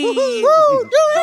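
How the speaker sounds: high, loud cheering whoop; excited whoop. Acted. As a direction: cheer the sledders on. Kids sledding, cheering.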